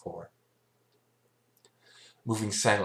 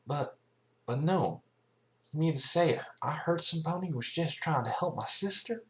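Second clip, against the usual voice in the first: higher, pained country pony voice; Applejack voice; 10 semitones higher than usual. Acted. Applejack realizes she wronged a helper, higher pained voice. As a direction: voice Applejack stunned and guilty.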